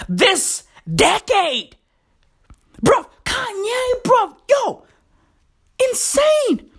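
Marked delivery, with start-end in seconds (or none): 2.8-6.7 exclaiming. Acted. Exclaiming about [name].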